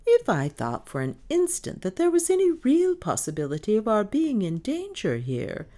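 high, deliberate and emphatic; measured seriousness. A narrator's reading. Mistress considering real danger, measured and serious. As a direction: weigh the thought seriously. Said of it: "she said slowly".

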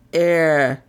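a lightly voiced Cockney 'Ere; Cockney 'Ere called out lightly; a yob's mild Cockney call in church; East End call. A narrator's reading. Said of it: "an East Enders coached voice".

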